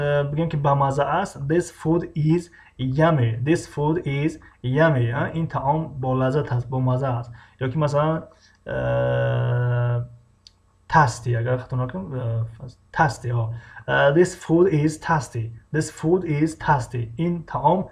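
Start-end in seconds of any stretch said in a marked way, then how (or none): 1.5-5.1 very slow example sentence; deliberate language-lesson enunciation; teacher modelling. Shown as a demonstration. 14.1-17.1 slow, drawn-out example sentence; deliberate language-lesson enunciation; teacher modelling. Shown as a demonstration.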